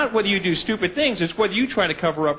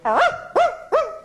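dog barking; much slower than usual. Retold or imitated. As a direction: bark like a big dog hidden in the aisle. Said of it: "I'll go".

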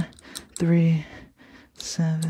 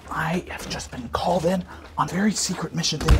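whisper; 5 dB quieter than usual. Pretend. Secret mission, whispering to camera.

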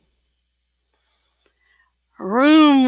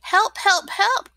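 high pleading cries rising higher; little truck crying out, high and stuck; crying for help; 9 semitones higher than usual. A narrator's reading. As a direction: call out for help, climbing up in pitch. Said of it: "cried the little blue truck".

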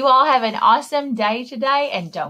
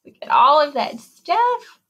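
higher, louder amazed exclaim; 5 semitones higher, 3 dB louder than usual. Acted. Librarian showing kids the messy hair, amazed. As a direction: marvel at the mess. Reacting to the picture.

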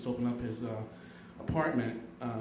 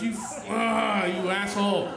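high, slow sputtering mock rage; frustrated anger; 5 semitones higher, 4 dB louder, slower than usual. Cursing the girl as she walks away, frustrated. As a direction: choke on the insult, then let it out. Rejected, curses her walking away.

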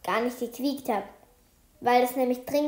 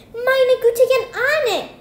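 much higher, loud exclamation; astonished; 12 semitones higher, 10 dB louder, much slower than usual. A narrator's reading. Teacher looks in the schoolbag and exclaims, high and loud. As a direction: cry out in shock. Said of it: "ruft Frau Scholler".

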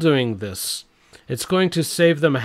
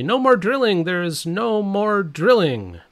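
higher, sharp snapped retort; mock snap; 6 semitones higher than usual. Biden snapping at a young woman in the crowd, mock snap. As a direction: snap it like a testy old man. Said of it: "Biden snaps at rally".

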